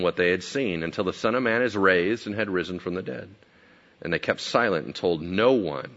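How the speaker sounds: usual speaking voice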